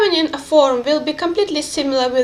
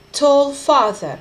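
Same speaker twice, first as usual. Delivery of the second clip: ordinary talk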